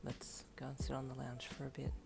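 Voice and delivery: low, gentle male voice; soft husband voice. Acted. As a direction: ease her along gently, low.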